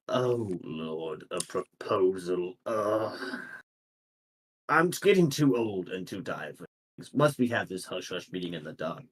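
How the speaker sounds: low, soft, tired grumble; weary old lord. Acted. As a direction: groan through it like someone too old for meetings. Game master voices weary lord.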